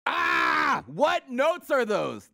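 high, drawn-out cry of dismay; mock horrified outcry. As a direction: wail in protest at awful singing. Mock horror at anthem singing.

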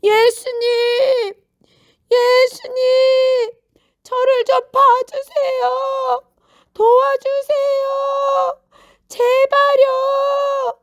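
very high, loud, stretched-out cries; long wailing pleas at full voice; persistent shouting. A narrator's reading. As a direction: keep calling desperately, dragging every word out. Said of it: "계속 소리치고 있었어요".

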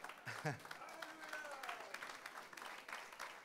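soft breathy laugh after the story's punchline. Real, not acted. Preacher laughing at his own story. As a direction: let a light laugh escape.

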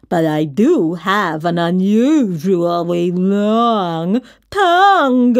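slow slurping monster, loud final word; Mac. A narrator's reading. Monster boasting to the kid, slobbery and slow. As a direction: slurp and stretch it, bursting out at the end. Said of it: "Mac slurped".

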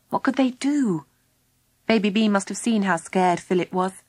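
ordinary delivery, nothing marked

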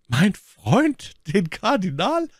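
high, rising startled exclamation; exclaimed question. A narrator's reading. Milady answering Lord Winter about the cardinal, exclaimed in high surprise. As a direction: throw it back as an astonished question. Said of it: "rief my Lady".

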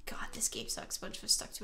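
muttered under the breath; whispered gripe. Real, not acted. Gamer whispering a complaint about the game. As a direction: whisper it in frustration. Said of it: "unvoiced whisper".

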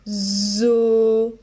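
"Zo" with a long buzzing z; drawn-out syllable. Shown as a demonstration. Teacher sounding out oiseau, drawn-out buzzed "Zo". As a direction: stretch "Zo", buzzing into the vowel. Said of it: "Alors, on y va".

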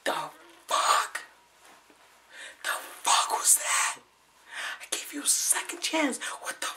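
harsh voiceless whisper. Real, not acted. Ranting to himself on camera, whispering. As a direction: whisper it all with sharp emphasis. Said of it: "Why am I whispering?".

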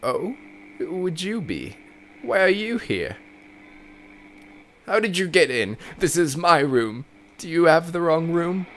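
put-on high, startled old woman voice; confused old mother. Mother finding a stranger in her room, startled and high.

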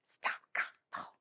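whispered Staccato; quiet whispered demo. Shown as a demonstration. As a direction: whisper each syllable detached. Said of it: "short and detached".

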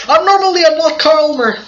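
high, loud lordly declaration; pompous mock voice. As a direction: proclaim it like a pompous lord.

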